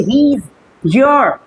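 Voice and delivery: he is your drawn out slowly as one word; contracted he's. Shown as a demonstration. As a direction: glide he is together, slowly. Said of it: "इसको pronounce करना है सही से". (he is your को धीरे जोड़कर)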